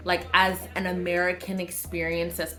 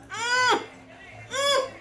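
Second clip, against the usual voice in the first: high pleased humming mm; high approving hum at the perfume; high appreciative mm. Real, not acted. Savoring the scent.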